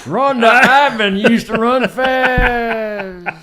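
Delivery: put-on high, slow old-timer voice; creaky grandpa telling a tale; very old man. Continuing the old-timer bit.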